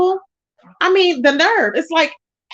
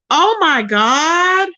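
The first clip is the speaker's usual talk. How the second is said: slow stunned; 4 semitones higher, much slower than usual. Real, not acted. Reacting to the gun news in chat, slow stunned. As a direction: let it sink in very slowly.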